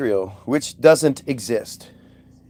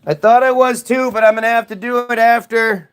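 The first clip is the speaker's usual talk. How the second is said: raised voice called out; calling out; 8 semitones higher, 4 dB louder than usual. Real, not acted. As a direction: call across the room. Answering someone off-camera.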